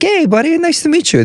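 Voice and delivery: high, chummy golf-buddy voice; friendly golfer greeting. Retold or imitated. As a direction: greet him like a polished club member. Golfers greeting him, acted.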